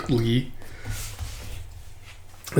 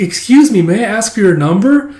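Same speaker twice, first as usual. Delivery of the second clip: high eager voice; 8 semitones higher, 5 dB louder than usual. Retold or imitated. Flirty character asking.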